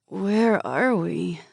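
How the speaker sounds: slow, slurred and groggy; slurred speech. A narrator's reading. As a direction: drag the words, half conscious. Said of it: "My speech sounded slurred".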